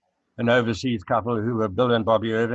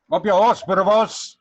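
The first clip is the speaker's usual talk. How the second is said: put-on broad South African accent, much higher and louder; 9 semitones higher, 8 dB louder than usual. Asked for a South African phrase, hammed-up South African accent. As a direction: belt it out in thick South African Afrikaans slang. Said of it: "the most South African thing you can say in a South African accent".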